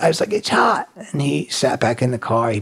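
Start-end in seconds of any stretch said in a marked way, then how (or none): 0.3-0.9 high, childlike mock whine. Complaining to the officer, feigned childlike whine.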